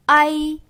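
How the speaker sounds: 아이 with a strong 아 and a barely touched 이; weak final i. Shown as a demonstration. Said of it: "이가 더 약하게 발음이 된다는 거".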